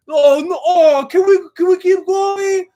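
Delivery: high, loud, pleading cries; excited shout. Real, not acted. Begging the fighters to keep going, loud and excited. Wants the rematch.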